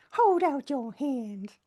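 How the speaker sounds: slow, drawn-out granny voice; a light granny offering a biscuit; old granny impression. Repeating the friend's granny line, slow granny voice. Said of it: "doing an impression of that old granny".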